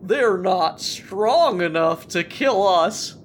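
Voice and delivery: a hint of a rallying snowman; new snowman voice. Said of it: "I'm gonna need a new voice".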